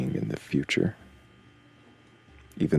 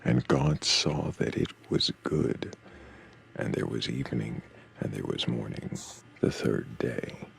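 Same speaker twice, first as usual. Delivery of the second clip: scripture reading. Acted. Reading Genesis passage aloud.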